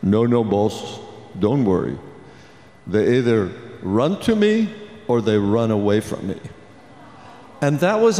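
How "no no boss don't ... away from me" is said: lower, calm big-man voice; gentle giant Andre. Retold or imitated. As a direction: reassure like a kind giant. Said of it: "And he goes".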